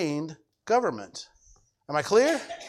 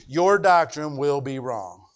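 ordinary talk, nothing marked

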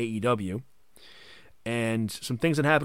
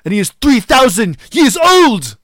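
excited exclamation; 12 semitones higher, 12 dB louder than usual. Real, not acted. Hyping Matt Hardy lore.

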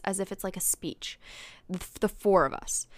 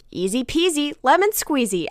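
higher, louder talking-toy chirp; Build-A-Bear voice; 7 semitones higher, 6 dB louder than usual. Retold or imitated. Right after the shot, chirped out like a talking toy bear. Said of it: "and I immediately say".

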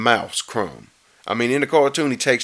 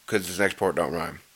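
low, near-whispered aside; hushed aside; 6 semitones lower, 9 dB quieter than usual. Real, not acted. Reader excusing a bad rhyme, hushed aside. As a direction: drop it right down under your breath.

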